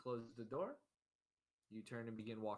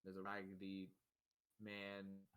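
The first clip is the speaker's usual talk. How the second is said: ordinary talk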